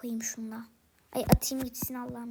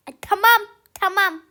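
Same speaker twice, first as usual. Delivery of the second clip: irritated; 4 semitones higher, 9 dB louder than usual. Acted. Snapping back at the scolding.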